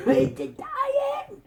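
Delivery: high, strained choking cry; mock choking panic. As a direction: gasp it out as if choking. Princess choking on an orange.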